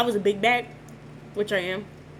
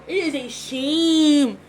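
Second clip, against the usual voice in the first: mock lament; 5 semitones higher, 7 dB louder, much slower than usual. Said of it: "so the camera can catch it".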